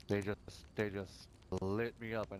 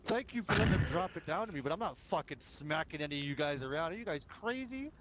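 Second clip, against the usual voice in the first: retold incredulous reply; 5 semitones higher than usual. Player retelling refusing a strange offer, high incredulous. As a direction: laugh off the offer in disbelief. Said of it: "And I'm like".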